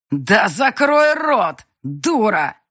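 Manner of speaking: loud, slow, furious yell. A narrator's reading. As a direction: bellow at her to shut up. Banker yells at screaming girl.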